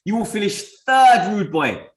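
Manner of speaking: ordinary talk, nothing marked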